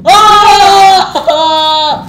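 startled cry. Real, not acted. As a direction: yelp as the monster appears. Jumpscare in horror game.

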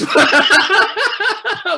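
loud high burst of laughter; big laugh. Real, not acted. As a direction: laugh at yourself. Laughs at his own cluelessness.

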